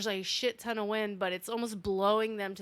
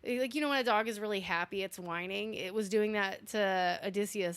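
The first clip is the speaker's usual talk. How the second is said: ordinary talk, nothing marked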